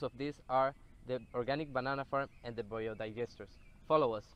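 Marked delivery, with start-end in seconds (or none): none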